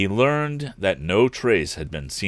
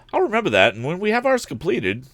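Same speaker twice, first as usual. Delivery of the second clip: lightly voiced young man, higher; a bright, cheerful lad, pitched up; higher young hero voice, eager; eager young inventor; 10 semitones higher than usual. A narrator's reading. Narrator voices Tom.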